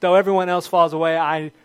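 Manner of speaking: loud, emphatic boast as Peter; loud boastful vow. Retold or imitated. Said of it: "Peter says".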